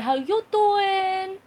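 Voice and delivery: high, slow "how you doin'" put-on; attempted American accent. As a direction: try a drawn-out American greeting. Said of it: "I can't do it like them. I can't do it 'cause I'm Scottish".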